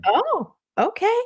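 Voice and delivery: very high, mock-knowing oh okay; sarcastic oh okay. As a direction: play it as a knowing, ironic aside. Mocking reply to client.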